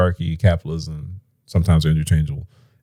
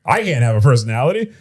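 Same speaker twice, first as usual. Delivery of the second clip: mock panicked man; 9 semitones higher than usual. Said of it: "It's like".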